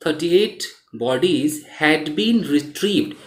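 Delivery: slow, measured read-out, word by word; slow, careful dictation pace; unhurried reading. Shown as a demonstration. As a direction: read it out at leisure for learners. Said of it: "इत्मीनान से पढ़ें आप".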